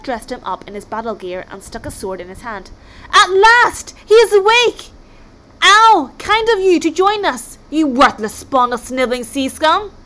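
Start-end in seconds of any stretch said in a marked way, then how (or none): 3.1-9.9 high, loud roaring insults in his face; bellowing Viking. Acted. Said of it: "bellowed a massive Viking".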